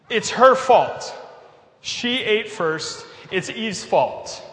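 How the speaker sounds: mock blaming. Adam throws his new wife under the bus, hammed-up blaming. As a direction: pin the blame on her, word by word. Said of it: "throws her under the bus as fast as possible".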